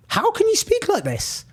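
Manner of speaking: appalled rhetorical question; incredulous outrage. Real, not acted. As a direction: ask it like you can't believe anyone would say it.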